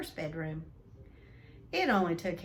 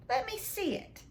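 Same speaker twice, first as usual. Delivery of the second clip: demanding; 10 semitones higher than usual. Acted. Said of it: "demanded Dudley".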